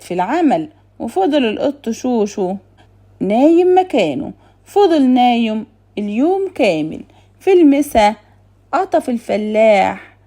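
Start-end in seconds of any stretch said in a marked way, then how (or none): none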